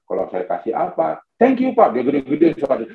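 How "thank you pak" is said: high-pitched thank-you; appreciative wife. Acted. Wife thanking her husband for any gift, high and appreciative. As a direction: lift it high, showing thanks. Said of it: "dia mengajarkan saya".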